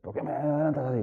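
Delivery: a touch of road rage; mock angry driver. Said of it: "te enfadas con él".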